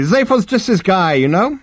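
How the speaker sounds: very high-pitched, breezy psychiatrist; bright. A narrator's reading. Psychiatrist dismissing his client's fate to the Vogon, bright. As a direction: shrug it off brightly. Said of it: "said Halfront brightly".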